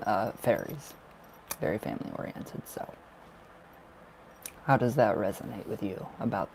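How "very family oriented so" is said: soft whispered echo. Real, not acted. Tarot reader echoing her own words to herself in a whisper. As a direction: whisper the phrase again, quick and low.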